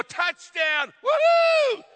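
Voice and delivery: high, loud whooping cheer; sports fan cheer. Acted. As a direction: yell it like a fan at a touchdown. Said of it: "you go to a game".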